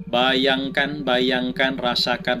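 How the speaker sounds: guided meditation. Acted.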